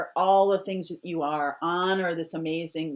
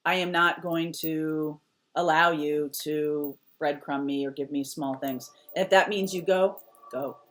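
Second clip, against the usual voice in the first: ordinary talk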